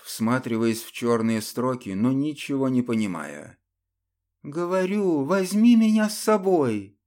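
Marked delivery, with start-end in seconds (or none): 4.4-7.0 insistent plea. A narrator's reading. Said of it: "не унималась монахиня".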